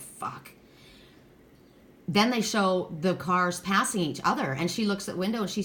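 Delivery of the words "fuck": hushed, barely voiced curse, imitated; stressed-out mutter. Retelling the groom stressing on wedding day, hushed stressed curse. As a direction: drop to a near-whisper, as if overwhelmed. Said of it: "He is so stressed out".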